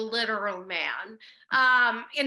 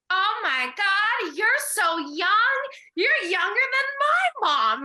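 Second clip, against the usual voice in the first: put-on high, slow, louder gushing little girl voice; seven-year-old niece; 10 semitones higher, 5 dB louder, slower than usual. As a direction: imitate an amazed child stretching out every word. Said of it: "she went".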